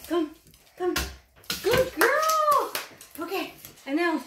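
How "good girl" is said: high, swooping dog praise; excited praise. Real, not acted. Owner praising the dog mid-course, excited praise. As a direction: coo it high and pleased.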